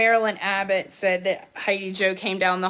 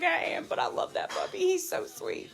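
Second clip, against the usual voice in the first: higher, softer, breathy-toned confiding; hushed mock confession; 9 semitones higher, 8 dB quieter than usual. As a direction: confide it like a secret, high and hushed. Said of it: "He's like".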